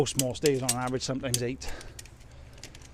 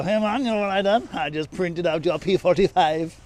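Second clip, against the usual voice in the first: loud mock Jamaican accent, starting high; jokey, loud Jamaican lilt breaking into a laugh; 5 semitones higher, 7 dB louder than usual. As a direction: ham up a Rastafarian Jamaican accent, drawing out the punchline.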